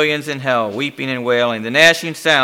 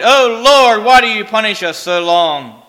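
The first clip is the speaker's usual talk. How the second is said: high, loud cry to God, falling away; anguished shout; 8 semitones higher, 7 dB louder than usual. A narrator's reading. Damned man questioning the Lord's punishment, shouting. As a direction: shout upward in protest, then sag. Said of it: "suddenly shouts with all his might".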